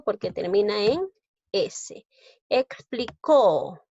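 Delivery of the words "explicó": "Explicó" very slow, CÓ held long; teacher's drawn-out "Explicó", last syllable stressed; stress on final CÓ. Shown as a demonstration. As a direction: punch and stretch CÓ in "Explicó". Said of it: "lleva la mayor fuerza de voz en co".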